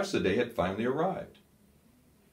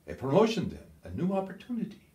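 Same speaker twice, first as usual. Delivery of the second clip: hopeful colonel; 9 semitones higher, faster than usual. A narrator's reading. Scholl hopeful reply.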